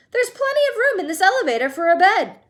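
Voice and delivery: fast, high and loud, bubbling; gleeful. A narrator's reading. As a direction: rattle it off with giddy delight. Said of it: "Wonka brushing off doubt".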